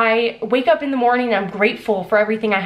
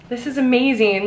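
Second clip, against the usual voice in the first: ordinary talk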